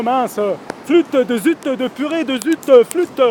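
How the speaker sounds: mock innocent cursing; mock-polite swearing. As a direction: swap the swear words for childish ones, hammed up. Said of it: "faut que j'arrête de dire des gros mots".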